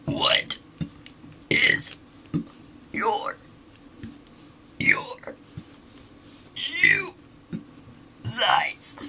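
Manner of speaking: words pushed out on a burp; burp talking. Shown as a demonstration. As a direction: burp each word out slowly. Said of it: "for me to try and burp".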